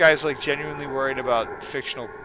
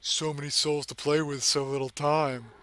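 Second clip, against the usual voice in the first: creepy villain; 5 dB quieter than usual. Acted. Voices the creepy Sonic text.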